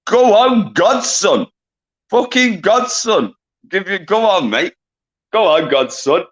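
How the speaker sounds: real excited hype for a winner; excited cheer. Real, not acted. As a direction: cheer him on with a burst of excitement.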